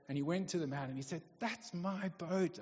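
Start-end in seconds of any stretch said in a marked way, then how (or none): 1.4-2.6 put-on boy's voice. Boy claims his boat.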